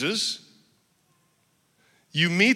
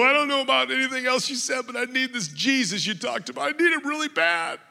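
high, fast, tearful retold plea; a hint of desperate crying as he begs; 7 semitones higher than usual. Desperate young man begging the preacher, high and tearful. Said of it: "and I'm crying".

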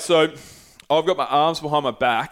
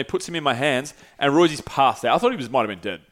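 ordinary talk

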